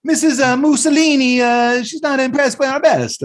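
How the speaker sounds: high, hammed-up Italian accent; mock Italian accent, way up high; Italian guy. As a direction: play a cartoon Italian counter man, high-pitched. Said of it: "that little Italian guy back there was like".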